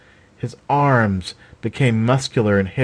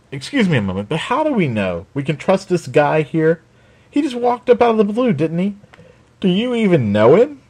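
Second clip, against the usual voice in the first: higher, sharp suspicious scout woman; Raven, suspicious; 8 semitones higher than usual. A narrator's reading. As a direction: question the stranger with clear distrust. Raven's voice in read story.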